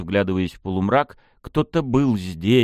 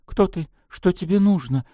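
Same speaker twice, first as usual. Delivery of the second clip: trembling voice; 9 semitones higher than usual. Acted. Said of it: "спросила она дрожащим голосом".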